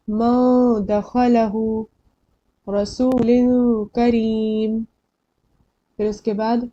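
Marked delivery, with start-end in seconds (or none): 0.1-4.8 मा and रसूलुन with long nasal holds; ikhfa recitation. Shown as a demonstration. Teacher reciting examples for learners to try, nasal holds. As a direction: slowly recite मा दखलहु, रसूलुन करीम, noons hidden in nose. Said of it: "कोशिश कीजिए आप लोग".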